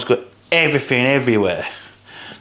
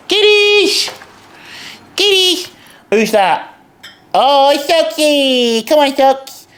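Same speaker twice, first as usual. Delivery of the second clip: calling the cats; 11 semitones higher, slower than usual. Real, not acted. Owner calling his cats to fresh beef, high sing-song call. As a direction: call the kitties to their bowl, way up high.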